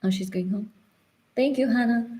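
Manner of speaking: ordinary delivery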